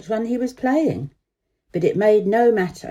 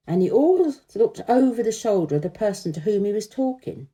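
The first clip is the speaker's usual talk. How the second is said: ordinary delivery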